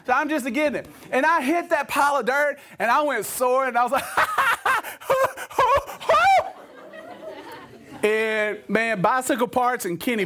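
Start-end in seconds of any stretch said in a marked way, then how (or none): 4.1-6.5 a high, gleeful whooping laugh mid-air; high delighted cackle; high giddy whoop. Retold or imitated. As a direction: whoop like a kid flying off a jump. Soaring off the dirt pile.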